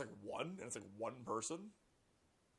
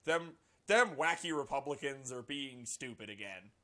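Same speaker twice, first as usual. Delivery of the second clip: mock dismissive; 8 dB louder than usual. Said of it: "and we'll all go".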